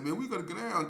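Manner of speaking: won't back down; insistent K P. Retold or imitated. K P to the narrator, high loud demand to fight.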